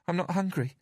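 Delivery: put-on high, small frightened child; timid little girl pleading; scared little girl. A narrator's reading. Narrator voices Bella.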